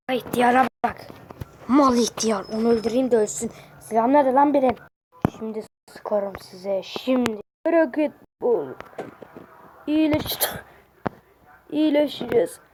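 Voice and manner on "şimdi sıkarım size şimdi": low, slow, softly spoken threat; quiet threat. Acted. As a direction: threaten them under your breath, slowly. Threatens to shoot.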